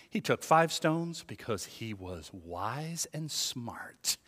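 slow, soft, low, near-whisper; hushed emphasis. Acted. Preacher explaining why David took five stones, slow hush. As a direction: spell out the plain truth slowly and quietly. Hushed counter-reveal after mocked theory.

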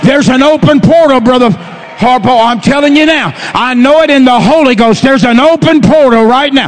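full-force Pentecostal sermon delivery; fervent preaching. Real, not acted. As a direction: shout the promise out to the whole congregation.

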